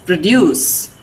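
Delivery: produce as pruh-DUCE; weak pro, strong DUCE in produce; second-syllable stress. Shown as a demonstration. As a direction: verb produce, stressed at the end. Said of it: "Second syllable is stressed for verbs".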